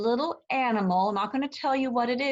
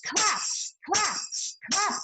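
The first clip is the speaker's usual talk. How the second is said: each Clap called out on the beat, a touch higher; rhythmic claps; 8 semitones higher, slower than usual. Shown as a demonstration. Mother Goose leading the children to clap along. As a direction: call out each clap in time. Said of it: "Can you clap?".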